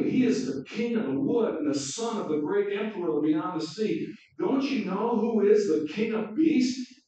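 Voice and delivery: loud, high, stern proclamation. Acted. Mr Beaver insisting to the children, loud and stern. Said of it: "Mister Beaver said sternly".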